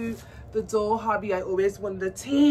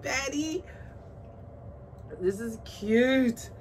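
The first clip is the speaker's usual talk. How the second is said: ordinary delivery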